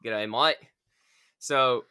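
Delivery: a quick mock Aussie greeting; terrible Aussie accent. Host greeting his Australian guest in a terrible Aussie accent. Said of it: "that was a terrible Aussie accent".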